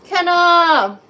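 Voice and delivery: loud, high call of a name. Real, not acted. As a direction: call her name across the room. Greeting a viewer by name.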